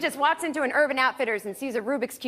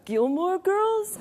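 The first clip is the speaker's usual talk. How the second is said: put-on very slow, higher, vacant teen drawl; dopey teenager; 7 semitones higher, much slower than usual. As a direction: play the clueless sleepy clerk, stretched out slowly. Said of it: "he was so stupid".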